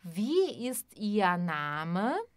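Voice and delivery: slow, clear German question; teacher modelling aloud; slow voiced demo. Shown as a demonstration. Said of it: "Por exemplo".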